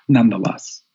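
normal talking voice

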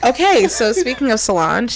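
normal talking voice

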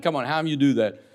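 normal talking voice

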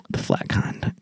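deadpan whisper; whispered answer. Retold or imitated. Retelling a kid's reply about cheese in a whisper. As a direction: breathe it out, no voice.

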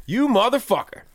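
high, loud yelled insult at a buddy; mock yell. Yelling at a car he thinks is his buddy's, high and loud. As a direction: shout it up high like catching a friend. Mistaking stranger for friend, yelling.

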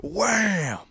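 high, punchy wham sound effect; playful sound effect of a hit; mock whack sound. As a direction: make the impact noise of the thrown dog. Imitating a dog hitting someone.

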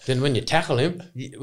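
ordinary delivery

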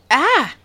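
sudden high yelp of fright; startled shout. A narrator's reading. Maya startled by a crow landing, sudden yelp. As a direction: jolt out a sharp ah. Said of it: "Maya shouted abruptly".

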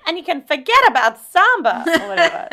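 high, loud put-on quote of Samantha; mock Samantha voice. Friend quoting Samantha's line to the others, in a high mock voice.

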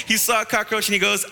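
usual speaking voice

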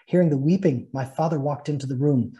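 ordinary talk, nothing marked

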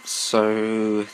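ordinary delivery

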